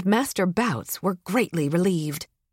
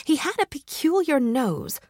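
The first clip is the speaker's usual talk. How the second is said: ordinary delivery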